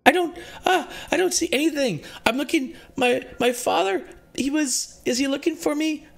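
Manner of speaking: put-on high, panicked little girl's voice; terrified girl's voice echoing in Sashira's mind; scared young girl. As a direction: plead like a lost, frightened child.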